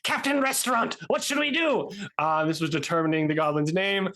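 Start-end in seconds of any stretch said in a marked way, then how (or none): 0.0-1.9 high goblin voice; goblin minions asking. Acted. Goblins asking their captain for orders. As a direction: ask the captain eagerly, pitched up. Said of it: "they're like".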